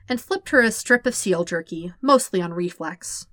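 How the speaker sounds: plain reading voice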